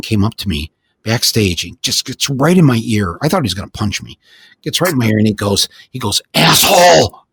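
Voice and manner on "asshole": mock yell of the insult, a bit louder; retold scream. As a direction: imitate the stagehand shouting into his ear. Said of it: "Just screams right in my ear".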